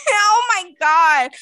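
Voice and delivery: laughing, gasping oh my God; cracking up, almost crying; laughing hard. Real, not acted. Cracking up at the story.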